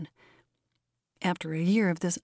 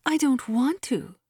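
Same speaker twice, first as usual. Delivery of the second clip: higher, drawn-out protest; reluctant cry; 6 semitones higher than usual. A narrator's reading. Faint-hearted Miss Matilda refuses to scold, reluctant cry. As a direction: cry out unwillingly, dragging the words. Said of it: "cried poor Miss Matilda".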